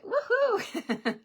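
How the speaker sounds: a high, gleeful woohoo cheered way up; excited cheer. Real, not acted. Host cheering to viewers that the taxes are finished, high excited woohoo.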